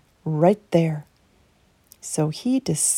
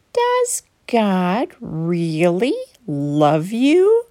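slow, sly serpent voice; snake voice; much slower than usual. Acted. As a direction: coax her slowly like a tempting snake. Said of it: "the serpent whispered".

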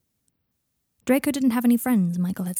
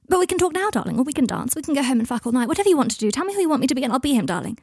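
high, rushed, eager gush; manic coaxing; 5 semitones higher, 4 dB louder, faster than usual. Acted. Draco trying to placate Michael, manic and coaxing. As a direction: tumble through offers, louder and higher. Manic offers to appease boyfriend.

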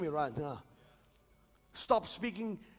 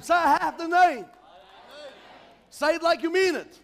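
loud, high call and response; rally the church to declare it; full-force preaching; 5 semitones higher, 6 dB louder than usual. Acted. Preacher leading the congregation to declare their weapons, full force.

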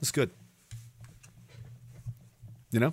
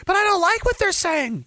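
high-pitched imitation of a complainer; mock whining. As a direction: whine it like a sulky objector. Mimics complainers, then answers them.

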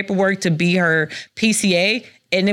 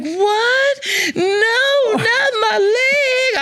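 high, crying wail, slowing at the end; a high, drawn-out tearful cry; mock wailing panic; 13 semitones higher, much slower than usual. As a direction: mom begs to keep her leg. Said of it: "And she like".

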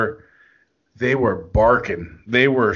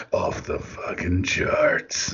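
low, slow whispered "Off the fucking charts."; smooth whisper; 8 semitones lower, 5 dB quieter, slower than usual. Shown as a demonstration.

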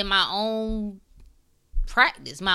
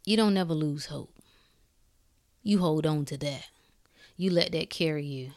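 softer and lower, settling; calming down; 6 semitones lower, 10 dB quieter than usual. Real, not acted. Speaker calming himself after getting excited, softer. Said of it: "Let me calm down 'cause I get excited".

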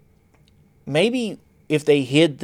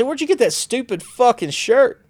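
high mocking teasing voice, pitched way up; mocking jab; 11 semitones higher, 4 dB louder than usual. Telling coworker in dish pit he looks dumb, high jeering mockery. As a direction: needle a coworker about his shirt in a high jeering voice. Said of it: "and I say".

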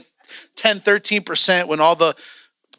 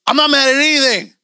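higher, louder defensive protest; re-enacted heated denial; mad denial; 7 semitones higher, 5 dB louder than usual. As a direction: insist you are not mad while obviously mad. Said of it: "What are you mad about".

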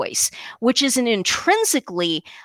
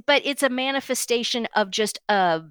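normal talking voice

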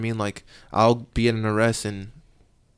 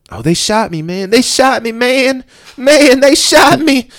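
high, loud hammed-up wail of "they shot me"; mocking a suspect crying he's been shot; whiny shot suspect; 13 dB louder, slower than usual. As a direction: play the overdone victim, whining loud and high. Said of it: "He said".

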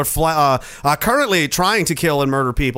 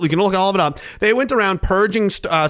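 usual speaking voice

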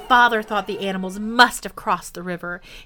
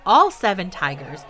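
usual speaking voice

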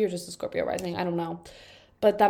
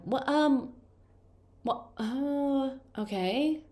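high, drawn-out baffled stammer; falter, lost for words, pitched up high; baffled reaction; 6 semitones higher, much slower than usual. Real, not acted. Reader thrown by a stranger's crush confession, baffled.

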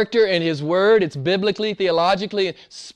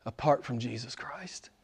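breathless whispered close; 5 semitones lower, 16 dB quieter than usual. Real, not acted. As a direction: drop to a bare whisper. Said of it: "sudden whisper after loud preaching".

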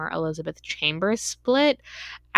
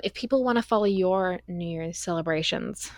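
normal talking voice

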